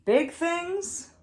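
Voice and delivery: usual speaking voice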